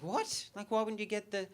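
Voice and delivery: high-pitched, incredulous pleading; baffled disbelief. Retold or imitated. Retold baffled reply to friend.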